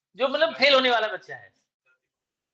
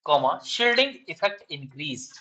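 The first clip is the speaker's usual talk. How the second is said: slow, deliberate dictation, each phrase spaced out; much slower than usual. Shown as a demonstration. Teacher dictating exam answer to students, slow dictation. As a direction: read out notes word by word for students to copy. Redictates after student missed part.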